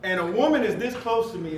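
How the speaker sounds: normal talking voice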